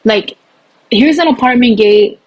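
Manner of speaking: normal talking voice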